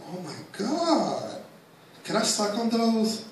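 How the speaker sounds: high, slow gushing voice; starstruck fan. Retold or imitated. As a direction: gush like an awestruck fan. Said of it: "I was like".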